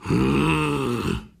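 a sulky wolf's grunt; grumpy wolf grumble. A narrator's reading. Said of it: "schimpfte der Graue".

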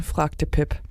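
usual speaking voice

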